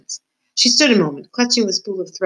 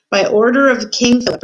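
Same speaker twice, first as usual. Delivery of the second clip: raised, formal soldier's voice reading out an order; official proclamation; 6 semitones higher, 5 dB louder than usual. A narrator's reading. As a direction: announce it loudly as a royal decree.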